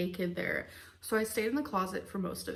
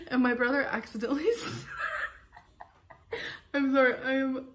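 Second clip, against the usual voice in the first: real giggles breaking in, louder; can't stop laughing; 3 semitones higher, 3 dB louder than usual. Real, not acted. Telling an embarrassing story about her brother, cracking up. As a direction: fight through laughter and lose it mid-word. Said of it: "I don't know why I'm laughing".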